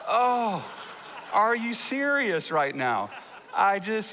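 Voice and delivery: hammed-up groaning dad who realizes his mistake; exasperated dismay. Young dad learns he punished his son wrongly, retold dismay.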